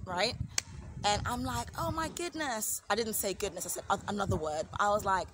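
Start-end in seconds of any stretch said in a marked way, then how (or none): 1.8-2.8 high, drawn-out cry of dismay; acted-out shock, slow and high; retold alarm. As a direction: stretch it out high in mock horror. Retold reaction to the crash.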